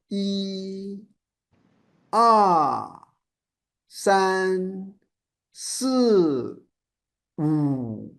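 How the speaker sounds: each of "E, R, san, si, wu" held then released; rainbow arcs. Shown as a demonstration. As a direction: draw out E, R, san, si, wu, each one long sound. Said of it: "I describe it as a rainbow".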